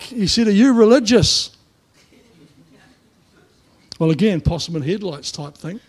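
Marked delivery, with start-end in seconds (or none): 0.5-1.5 high, loud call across the site; retold yell. Builder yelling across the smoko room, loud. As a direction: bellow the question across the crowd. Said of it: "he yells across".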